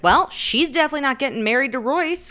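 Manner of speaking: huffy acted retort; a little pissed. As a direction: imitate someone mildly pissed off. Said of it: "now a little pissed".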